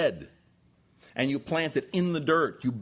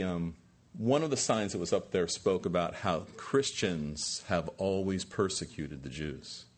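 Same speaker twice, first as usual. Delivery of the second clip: normal talking voice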